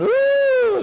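high loud mourning howl; imitating a woman's high howl; imitated wail. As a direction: let out a high grieving howl. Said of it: "I heard somebody going".

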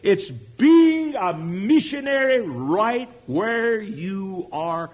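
fiery sermon shout, drawing out the key words; full-force preaching. Acted. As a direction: shout it out like a revival preacher, loud and high.

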